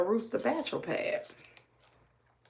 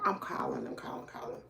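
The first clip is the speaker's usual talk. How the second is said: usual speaking voice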